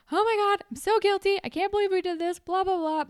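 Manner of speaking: high, excited mock gush; gushing guilty friend. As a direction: play up the guilty schoolgirl. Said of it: "she's like".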